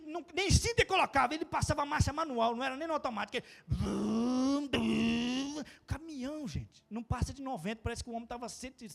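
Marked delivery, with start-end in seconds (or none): 3.4-5.6 engine vroom. Retold or imitated. Imitating truck driver revving.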